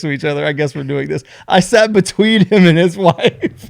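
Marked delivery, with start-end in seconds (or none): 1.5-3.7 slow, higher and louder, laughing through it. Real, not acted. Telling a friend about the plane seat, laughing. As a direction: laugh at the awkwardness as you say it. Awkward seat between strangers.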